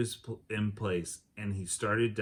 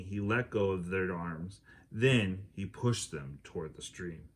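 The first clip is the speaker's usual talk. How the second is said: ordinary delivery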